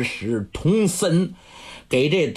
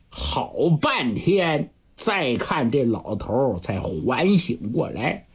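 plain reading voice

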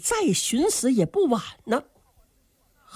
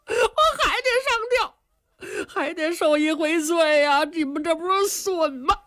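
higher, crying complaint that rushes at the end; sobbing despair; 9 semitones higher than usual. Acted. Young man sobs to Qin Qiong that he must die. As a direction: weep out your hopeless story.

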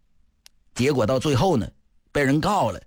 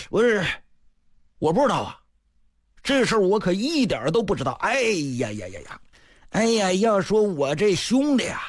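higher, pouting bluster of a shameless squire; pouting braggart; 6 semitones higher than usual. Acted. Yan dodging the brothers' accusation, higher pouting bluster. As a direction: feign innocence and brag, lips pursed. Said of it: "他撇吃拉嘴来了这么一通".

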